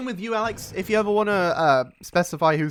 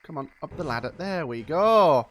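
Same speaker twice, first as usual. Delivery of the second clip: louder, drawn-out coaxing; urging on; 7 dB louder than usual. Real, not acted. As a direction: coax the character up. Urging character up ladder.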